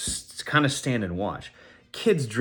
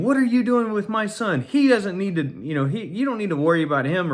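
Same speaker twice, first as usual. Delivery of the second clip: high, scolding mock yell; imitated yelling; 9 semitones higher than usual. Mom confronts a stranger over her son, imitated yelling. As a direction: imitate an irate mom, pitch jumping up then easing. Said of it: "starts yelling at me and saying".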